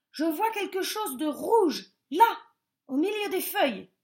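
eager discovery; excited. A narrator's reading. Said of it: "s'écria un des lapins".

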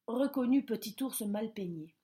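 usual speaking voice

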